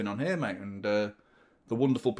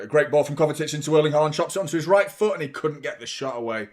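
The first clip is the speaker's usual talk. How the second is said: louder, quicker play-by-play call; live commentary; 2 semitones higher, 6 dB louder than usual. Acted. Commentating the City attack for viewers, lively play-by-play. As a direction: call the move as it happens.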